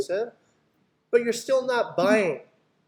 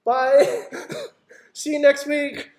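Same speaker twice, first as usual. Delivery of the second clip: airy, high, laughing see-you-next-week; mock the salesperson who just walks off; limp goodbye; 8 semitones higher, 6 dB louder, much slower than usual. Shown as a demonstration. Coach mocking a seller's lame exit, loud and high. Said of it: "don't leave it there and be like".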